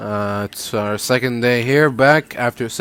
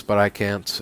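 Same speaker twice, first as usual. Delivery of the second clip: ordinary talk, nothing marked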